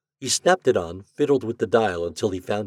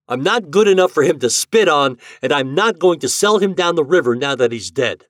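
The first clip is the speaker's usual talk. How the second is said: loud firm refusal; defiant refusal; 8 semitones higher, 10 dB louder than usual. Acted. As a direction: refuse him flat out.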